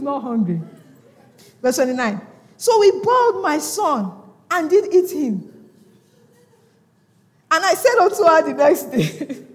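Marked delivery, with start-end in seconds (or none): 2.6-9.4 high, loud scripture reading; preach at full force. Real, not acted. Preacher rereads the grim verse to church, loud declamation.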